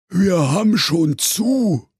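slow groaning civil-servant zombie; zombie clerk. Acted. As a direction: drone it like a dead-tired office zombie.

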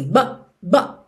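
"but" with the final T cut off; swallowed T. Shown as a demonstration. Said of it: "como se você comesse o som". ("but" comendo o T final)

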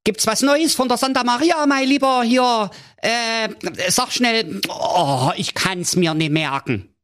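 high, eager king voice; expectant king. A narrator's reading. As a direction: ask like an impatient fussy king. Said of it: "sprach da der König erwartungsfroh".

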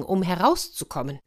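plain reading voice

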